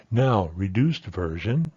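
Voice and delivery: normal talking voice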